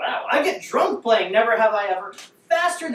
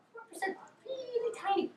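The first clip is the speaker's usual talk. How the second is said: very high, very soft little voice; tiny voice; 12 semitones higher, 13 dB quieter than usual. Acted. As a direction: squeak it out small and quiet. Playing up how small the number is.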